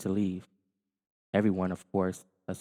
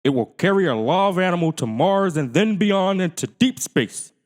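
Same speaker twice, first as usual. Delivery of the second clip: loud, higher formal news voice; newscaster; 10 semitones higher, 9 dB louder than usual. Acted. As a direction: announce like a TV newscaster. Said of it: "said the newscaster".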